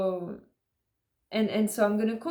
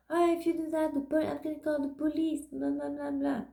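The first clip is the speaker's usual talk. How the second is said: higher-pitched mimicry of a threat; mock threat; 6 semitones higher than usual. Neighbour threatening to call the police, higher mocking voice. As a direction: lightly mimic a nagging threatener.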